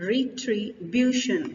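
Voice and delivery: "retribution" said slowly in parts; syllable breakdown. Shown as a demonstration. Teacher repeats the word for learners to count syllables, slow breakdown. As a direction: sound out "retribution" slowly, part by part. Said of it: "इसमें चार syllable आ गए आपके".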